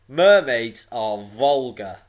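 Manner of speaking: loud, slow stony disdain; big stony monster, drawing out the words; booming. A narrator's reading. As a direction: boom the verdict out slowly. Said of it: "It boomed again".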